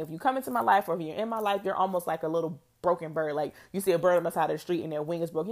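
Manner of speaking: ordinary delivery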